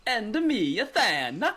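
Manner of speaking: high, loud cartoon Italian voice; fake Italian accent. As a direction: ham up a Mario-style Italian accent. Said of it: "this whole Italian minstrel show".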